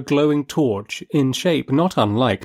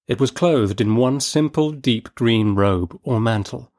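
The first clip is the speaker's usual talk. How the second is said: ordinary delivery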